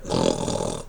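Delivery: low, rattling mock snore; imitated snore. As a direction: snore like a sleeping dad. Imitates dad's snoring resuming.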